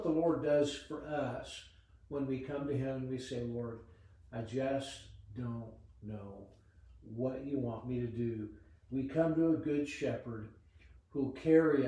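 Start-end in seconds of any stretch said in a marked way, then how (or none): 3.4-8.5 humble plea. Retold or imitated. Humble prayer voiced for listeners.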